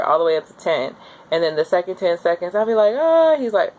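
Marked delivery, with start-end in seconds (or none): 3.0-3.4 pained cry. Retold or imitated. Contraction peak cry.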